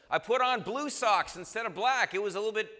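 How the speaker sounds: loud, high petty complaining; hammed-up exasperation over nothing; mock irritation. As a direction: whine loudly about a trivial mishap. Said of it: "You're just mildly irritated".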